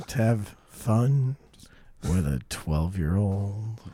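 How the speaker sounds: slow, low creepy drawl; lightly voiced creep, slowing down. Imitating creep, voice drops.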